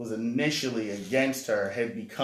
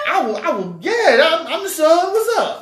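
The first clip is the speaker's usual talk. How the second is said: imitating girl; 11 dB louder than usual. Girl faking being the son.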